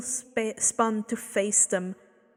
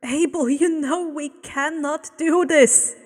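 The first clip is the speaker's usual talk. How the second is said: a raised, urgent plea; imploring; 5 semitones higher, 6 dB louder than usual. Acted. Woman implores Abel to stop, raised and urgent. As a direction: beg him to stop. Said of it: "the woman implored".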